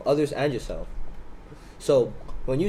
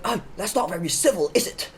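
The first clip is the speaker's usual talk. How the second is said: higher-pitched mock reproach; affronted stranger; 6 semitones higher than usual. Stranger reproaching his train neighbour, higher-pitched affronted mimicry. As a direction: imitate a man taking offence, pitched up.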